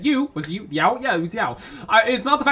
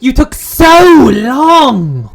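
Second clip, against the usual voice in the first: character voice; 5 semitones higher, 9 dB louder, much slower than usual. Acted. Animal character complaining.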